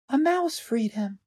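high, soft, slow bird voice; plain-spoken little bird. A narrator's reading. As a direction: state it plainly in a tiny voice. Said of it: "the bird said plainly".

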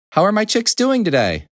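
high-pitched hen voice; mother hen character. A narrator's reading. Little Red Hen asking after chicks, high voice.

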